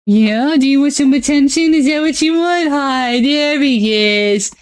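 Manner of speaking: dote on the pups like babies. Real, not acted.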